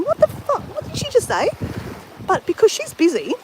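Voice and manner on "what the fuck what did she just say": much higher, indignant outburst; imitating her own outraged reaction; high-pitched angry disbelief at a rude coworker; irate. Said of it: "like, irate".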